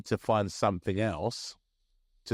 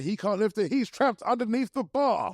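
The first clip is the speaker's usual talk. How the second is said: sports commentator; 11 semitones higher than usual. Pretend. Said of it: "over-the-top sports commentator voice".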